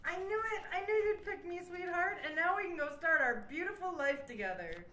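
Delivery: high, gushing delight; overjoyed and sweet-talking; thrilled suitor. Acted. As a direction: gush in a high, lovestruck rush. Suitor thrilled at being picked.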